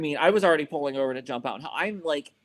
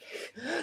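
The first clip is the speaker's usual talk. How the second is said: high laugh; 13 semitones higher, 9 dB quieter than usual. Real, not acted. Laughs at instructor's order.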